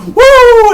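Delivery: a high, loud Ric Flair woo; high woo yell. Retold or imitated. Imitating Charlotte's woo cry to the crowd, loud and high. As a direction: belt out a shrill woo. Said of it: "And he's like".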